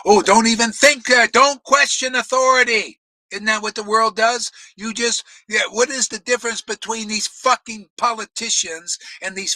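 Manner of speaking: shouted high and loud, mocking blind obedience; fiery preaching. Preacher rails against gurus and politicians, loud furious preaching. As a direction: rant full force against gurus and politicians.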